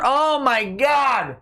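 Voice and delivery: high, drawn-out shout; big exclamation. Real, not acted. Streamer reacting to a viewer's super chat, exclaiming. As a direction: cry it out long and high.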